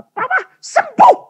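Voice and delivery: very high, squealed news; imitating mother's elated cry; excited announcement. Mother announces her healing.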